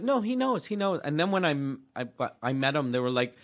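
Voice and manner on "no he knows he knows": high-pitched mock insistence; insistent protest. Festival staff correcting the comic about Cosby, insistent.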